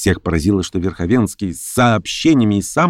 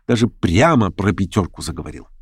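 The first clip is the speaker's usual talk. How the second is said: ordinary delivery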